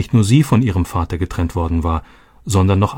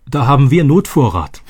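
loud, high blurt; 5 semitones higher, 4 dB louder than usual. A narrator's reading. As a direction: burst out with it. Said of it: "platzte Robby heraus".

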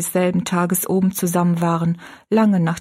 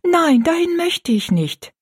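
put-on high, drawn-out girl's refusal; Rosalie protesting; 11 semitones higher, 3 dB louder than usual. A narrator's reading. Rosalie begs her stepmother not to send her, high and protesting. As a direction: plead against it in a much higher voice. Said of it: "sagte Rosalie rasch".

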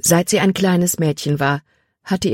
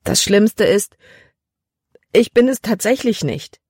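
higher, whimpering plea; wailing; 4 semitones higher than usual. A narrator's reading. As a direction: wail it through tears, a little higher. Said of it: "jammerte sie". (weinerlich jammernd, etwas höher)

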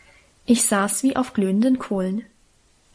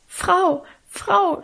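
put-on higher, breathless cry of a scared girl; stammering in fright; 9 semitones higher than usual. A narrator's reading. Maid bursts in crying to the farmer's wife, frightened stammer. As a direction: blurt it out, frightened and stumbling. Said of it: "stammelte sie".

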